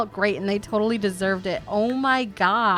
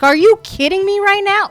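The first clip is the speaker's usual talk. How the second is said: mock outrage; 11 semitones higher, 7 dB louder than usual. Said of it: "She's like".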